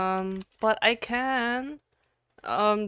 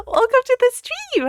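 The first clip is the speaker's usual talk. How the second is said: excited welcome; 7 dB louder than usual. Real, not acted. Welcoming a viewer.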